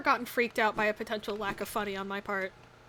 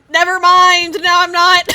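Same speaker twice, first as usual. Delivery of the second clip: loud, high frustrated yell; shouting; 8 semitones higher, 20 dB louder, slower than usual. Real, not acted. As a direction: shout it as things go wrong. Gameplay reversal, shouting.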